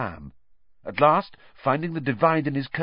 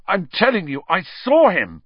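higher, louder urgent insisting; insistent; 8 semitones higher, 7 dB louder than usual. Acted. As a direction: insist he saw it. Boy insists.

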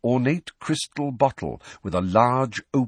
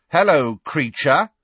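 much louder, higher pointed greeting; very loudly; 7 semitones higher, 8 dB louder than usual. Acted. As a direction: call it out loud to catch him. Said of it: "said Fred, very loudly".

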